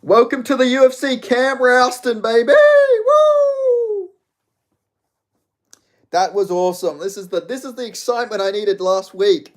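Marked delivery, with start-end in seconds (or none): 0.0-4.1 high, loud mock-announcer cheer ending in a whoop; excited announcing yell. Real, not acted. Fan welcoming Cam Ralston to the UFC, loud hype yell. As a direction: hype him up like a ring announcer.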